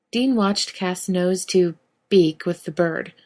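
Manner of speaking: ordinary delivery, nothing marked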